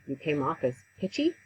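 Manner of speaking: ordinary delivery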